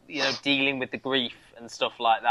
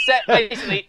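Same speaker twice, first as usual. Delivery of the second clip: laughing; 9 semitones higher, 5 dB louder, faster than usual. Real, not acted. Laughing through words.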